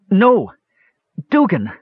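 high, loud cry of protest; high-pitched panicked outburst; frantic cry. A narrator's reading. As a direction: cry out frantically to stop him. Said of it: "Palin cried frantically".

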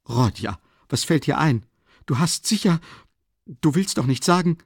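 high, alarmed, breaking off mid-sentence. A narrator's reading. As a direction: react startled, pitch climbing as you go. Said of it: "begann Pulcheria Alexandrowna erschrocken".

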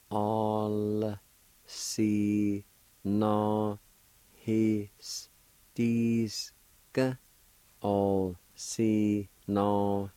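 "synohistisk" drawn out very slowly. Shown as a demonstration. Teacher slowly modelling the Cherokee word. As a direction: stretch each sound of "synohistisk". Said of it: "like this".

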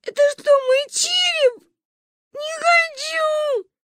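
high crying shout, last words dragged slow; whining child. Acted. Scared girl wails at grandma over the X-rays. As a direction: wail like a scared little girl. Manya whines about skull X-rays.

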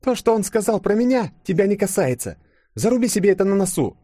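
high, hard-edged telling-off; stern warning. A narrator's reading. Yulia forbidding Denis to pry, stern. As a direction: warn him off, pitched well up. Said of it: "взгляд резко стал жёстким".

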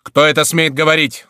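high, loud demand; indignant challenge. A narrator's reading. Crillon below demanding who dares speak, indignant. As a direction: challenge whoever dared to speak.

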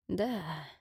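soft, hoarse wounded rasp; hoarse wheeze. Acted. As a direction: croak it out through the pain. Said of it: "прохрипел раненый".

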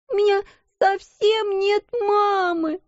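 put-on high, slow childish wail; whining child. A narrator's reading. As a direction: let the whine swell.

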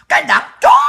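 high, loud shrieked insult; enraged outburst at the party; furious shriek. Real, not acted. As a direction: hurl it at the top of your voice.

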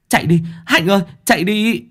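high urgent cry; panicked. A narrator's reading. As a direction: yell at her to run. Girl urging friend to flee.

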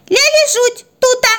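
put-on very high toddler squeak; babbling baby puppy. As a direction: chirp it out in baby talk. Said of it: "донеслось из-под окна". (писклявый детский лепет)

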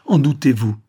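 wounded, emphatic reproach; reproachful. A narrator's reading. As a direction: protest at being doubted, pitch lifted. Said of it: "fit-il d'un ton de reproche".

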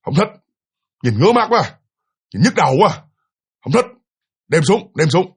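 mildly imitated snapping refusal. Mother snapping at her husband, lightly imitated. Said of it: "mẹ bà nạt như vậy đó".

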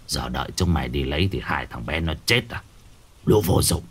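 put-on low, scornful old man; contemptuous shaman. Shaman scolding the useless helpers, low and contemptuous. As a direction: spit out the scorn low and quiet.

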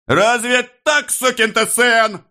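very high, squealing rage; shrieked. A narrator's reading. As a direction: squeal the rebuke in outrage. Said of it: "взвизгнул Становой". (визгливо, в ярости)

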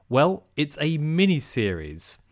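ordinary talk, nothing marked

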